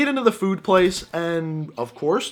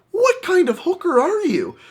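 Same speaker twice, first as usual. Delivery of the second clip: high-pitched, appalled question; incredulous outburst; 13 semitones higher, 4 dB louder than usual. Acted. Man to pushy hooker offering a freebie, incredulous. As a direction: blurt it in high-pitched disbelief.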